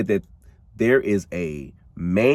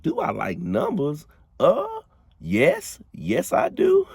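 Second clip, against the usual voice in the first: voicing Alice; 11 semitones higher, slower than usual. Alice answers Beverly.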